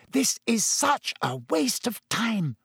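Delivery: high, tense hiss through her teeth at a slow pace; an irritated hiss. Acted. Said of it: "Hermione hissed".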